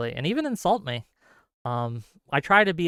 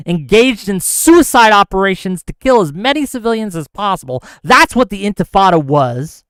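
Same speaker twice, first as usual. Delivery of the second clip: loud, raised, forceful rebuttal; loud indignant lecture, pitch up; heated rant; 4 semitones higher, 8 dB louder than usual. Real, not acted. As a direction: hammer each point home angrily.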